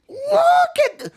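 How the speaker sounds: very high squeal of excitement; squealing look at. Real, not acted. Reactor pointing at Moro's face, high squeal. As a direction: shriek it out in delight.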